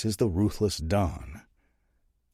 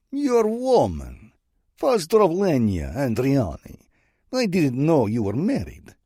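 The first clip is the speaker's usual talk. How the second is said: much higher, louder, slow taunting Russian drawl; mocking Russian accent; 8 semitones higher, 6 dB louder than usual. Bratva boss teasing Saint about his woman, taunting Russian accent. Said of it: "a voice laced with a Russian accent".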